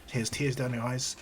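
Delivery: usual speaking voice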